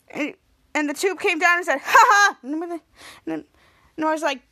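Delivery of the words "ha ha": loud, high two-beat ha ha; imitated laugh. Tube comes down on Miles, imitated laugh. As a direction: bark out a fake laugh.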